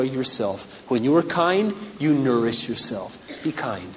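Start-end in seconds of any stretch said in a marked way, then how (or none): 3.4-3.9 soft, low, quiet appeal; almost whisper it, low and gentle; hushed plea. Acted. Hushed exhortation.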